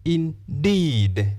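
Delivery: "indeed" split, DEED drawn out; "indeed" as in... DEEED, falling; long second syllable. Shown as a demonstration. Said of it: "second syllable has the long vowel".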